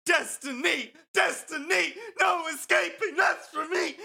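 high shouted nightmare chant; yelling in sleep. Retold or imitated. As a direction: yell it like a dream gone wrong. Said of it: "doing this stupid chant".

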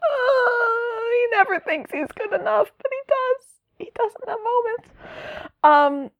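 very high, quivering fangirl gush; high emotional gush. Real, not acted. Fan gushing about Tim, very high emotional voice. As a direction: gush over the character, almost tearful.

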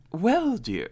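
high, sugary mock stepmother voice; a hammed-up prim stepmother greeting. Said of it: "she's like".

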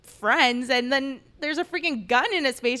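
high, loud outraged protest, retold; screaming in protest. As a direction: relive yelling at the police in panic. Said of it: "like, screaming".

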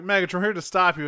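mock heroic charge; heroic announcement. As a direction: burst in like action heroes. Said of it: "it's like".

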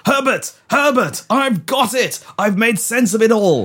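high, triumphant epiphany; stagey eureka cry; excited calling out. Acted. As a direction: call out your discovery in high excitement. Calls out his breakthrough.

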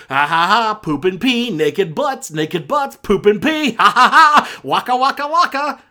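loud, hammed-up fake laughing; mock hilarity. Reviewer parodying a film's crude jokes, mock hilarity.

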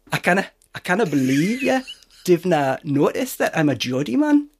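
a lightly put-on, unconvincing Geordie accent; bad Geordie accent. Shown as a demonstration. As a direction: try a Geordie accent and fall short. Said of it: "Shall I try and do a Geordie accent?".

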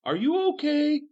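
high, soft, squeaky question; odd concern. Acted. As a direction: ask it small and very high.

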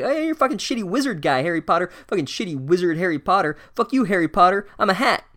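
high, fast squabbling hat; mock indignant rant. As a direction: hurl childish insults in rising outrage. Hat insults Harry back, acted quarrel.

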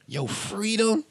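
mocking high disbelief; scornful squeak on freedom; incredulous. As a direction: echo it back in high, scoffing disbelief.

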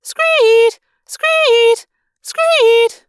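high, loud screeching alarm noise; howling alarm. A narrator's reading. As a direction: screech like a blaring siren, three times. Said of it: "The alarm was howling".

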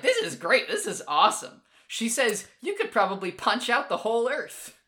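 lightly voiced eager girl; excited Uran. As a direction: gush like a thrilled kid. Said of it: "Uran is like".

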